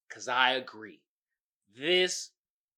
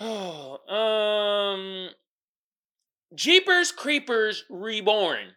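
mock sad; 5 semitones higher, 3 dB louder, much slower than usual. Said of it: "the sad segment".

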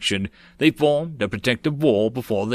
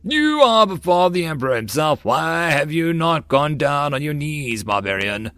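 indignant scolding; 4 semitones higher than usual. Acted. Eunuch scolds the barbarian.

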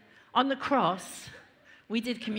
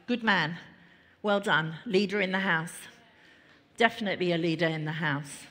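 normal talking voice

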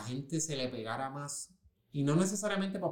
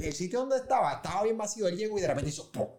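usual speaking voice

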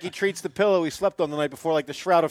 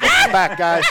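mock announcement; 3 semitones higher, 9 dB louder, slower than usual. Mock Jesus returning from cave.

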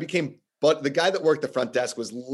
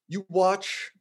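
normal talking voice

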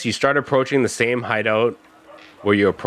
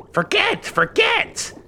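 high shrill cry of forget; shrieking witch; slower than usual. Acted. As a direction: screech it like a mad old witch. Witch teaching Crick.

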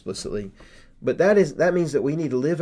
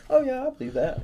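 high, airy, offhand "oh yeah"; casual shrug; 12 semitones higher, faster than usual. Retold or imitated. Pastor mocking a lazy, casual faith, lightly high and offhand. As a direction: toss it off like it costs nothing. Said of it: "it's not just like".

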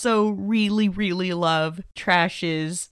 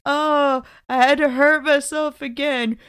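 raised, cooing reassurance with a laugh creeping in; mock devoted lover, slowing on the last words; lightly hammed sweet-talk; lovesick movie quote; 4 semitones higher than usual. Acting Freddy's lovesick lines again.

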